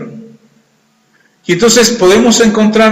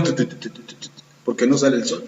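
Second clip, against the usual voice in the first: whispered chattering tu tu tu, much softer; teeth-chattering shiver; 6 semitones lower, 11 dB quieter, faster than usual. Pretend. The preacher pictures his listeners freezing without sun, whispered chatter. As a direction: mime shivering in the cold, whispered.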